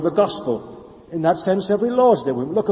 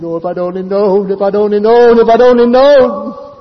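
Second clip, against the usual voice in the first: retold anguished scream; 3 semitones higher, 10 dB louder than usual. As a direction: wail of regret building louder and higher with each repeat. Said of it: "She blurted out and screamed".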